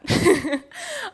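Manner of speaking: high, loud burst of laughter; real laugh. Real, not acted. Speaker amused by her own plea to the audience, high laugh.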